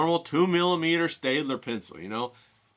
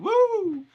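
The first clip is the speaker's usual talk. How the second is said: excited whoop. Real, not acted. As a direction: let out a joyful woo. Celebrating day one.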